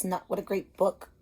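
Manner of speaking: usual speaking voice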